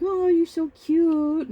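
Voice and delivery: high, slow, drawn-out coo; cooing over a baby. Retold or imitated. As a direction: imitate guests fawning over a cute baby.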